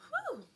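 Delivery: loud, high exhale to hold back tears; real steadying whew; tearful whew. Real, not acted. Streamer crying on live, blowing out a tearful whew. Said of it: "I hate crying on live".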